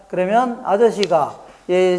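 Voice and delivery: ordinary talk, nothing marked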